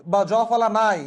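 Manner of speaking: ordinary delivery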